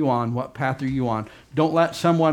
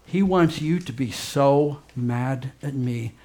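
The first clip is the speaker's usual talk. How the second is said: ordinary talk, nothing marked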